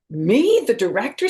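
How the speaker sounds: high, loud startled question; surprised. Acted. Arthur stunned at being picked director, high surprised cry. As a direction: sound caught off guard by the news.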